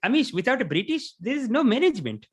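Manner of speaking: high, put-on Indian accent, pleading; mock Indian uncle voice; uncle's Indian accent. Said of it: "He's like, he's like".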